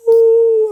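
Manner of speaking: high, loud drawn "ooh"; excited ooh. Real, not acted. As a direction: cry out, fired up by your own words. Hyped again mid-sermon.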